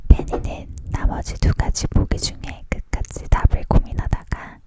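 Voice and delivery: usual speaking voice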